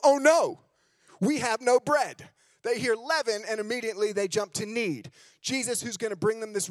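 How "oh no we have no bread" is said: higher, louder panicked disciples; mock panic. Disciples panicking to Jesus about the bread, mock alarm. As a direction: play it as a mock panicked cry.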